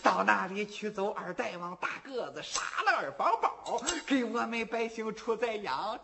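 put-on very soft, puzzled old man thinking aloud under his breath; quiet muttering old villager; old man muttering to himself.

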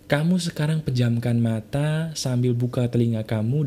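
normal talking voice

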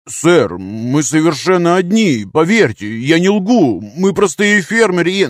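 high, loud protest of innocence; rising, urgent begging; anxious pleading. Acted. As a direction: plead for belief, pitch climbing. Frightened farmer pleading innocence.

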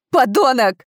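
high, loud spat insult; furious insult. A narrator's reading. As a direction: hurl the insult in rage. Empress attacks her husband.

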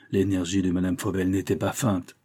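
usual speaking voice

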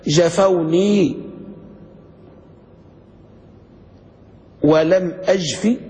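جفوني ولم أجف drawn out slowly, with a pause; slow verse recitation. Shown as a demonstration. Teacher recites the poetic example to the class, slow and deliberate. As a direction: recite the line of verse with weight. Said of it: "قال".

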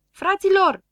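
high, loud cry of protest; yelling. A narrator's reading. Insulted friend appealing to the others, yelling. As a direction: yell out to the others. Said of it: "țipă Grăbilă".

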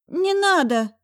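high, loud choked plea; frightened doctor pleading with the visitor; choked nasal plea. A narrator's reading. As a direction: beg him to stop. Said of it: "сдавленно прогнусил врачишка".

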